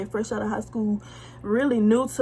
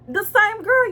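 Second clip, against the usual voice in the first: much higher and louder, exasperated; indignant emphasis; 11 semitones higher, 6 dB louder, slower than usual. Real, not acted. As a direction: hit it in disbelief to the viewers. Outraged reveal to her viewers.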